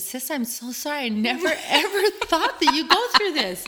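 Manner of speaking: apologizing to her sister through laughter; laughing apology. Retold or imitated. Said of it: "And I said".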